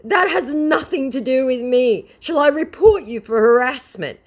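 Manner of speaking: high, loud indignant viewer; mock viewer voice. Vlogger voicing the viewer's indignant reply. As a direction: push back high and indignant. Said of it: "my viewer goes".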